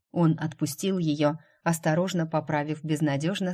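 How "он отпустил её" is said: plain reading voice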